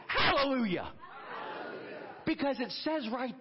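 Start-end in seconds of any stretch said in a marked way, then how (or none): 0.1-0.9 Hallelujah called out firmly and louder; spoken hallelujah. Shown as a demonstration. Pastor leading the church in praise, calling Hallelujah aloud. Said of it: "let's just say this". 0.9-2.0 Hallelujah breathed in a soft whisper. Shown as a demonstration. Pastor hushing the congregation, whispering Hallelujah. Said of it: "let's just say this".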